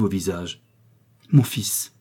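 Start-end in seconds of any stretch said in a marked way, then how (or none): 1.3-1.9 understated stern address; solemn severity. A narrator's reading. Mother about to punish her son, gravely stern. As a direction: address the child with grave authority. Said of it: "soudain pénétrée du sentiment d'un grand devoir".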